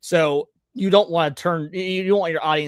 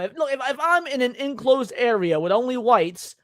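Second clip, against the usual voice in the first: ordinary talk, nothing marked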